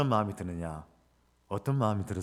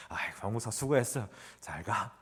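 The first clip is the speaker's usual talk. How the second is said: elder's farewell; 4 dB quieter than usual. Retold or imitated. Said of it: "이런 식으로 하면서".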